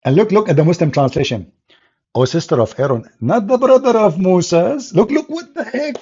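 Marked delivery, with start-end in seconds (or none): 3.2-6.0 high, laughing disbelief; can't believe what you're reading; incredulous. Real, not acted. Incredulous at the translation.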